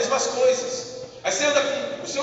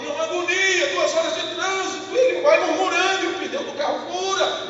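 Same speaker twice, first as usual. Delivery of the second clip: agitated; 7 semitones higher, 4 dB louder than usual. Acted. Describing stressful traffic morning.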